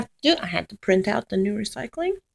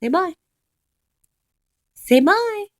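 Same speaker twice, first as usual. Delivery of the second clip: high coaxing; 10 semitones higher, 5 dB louder than usual. Real, not acted. Coaxing the dog to say bye.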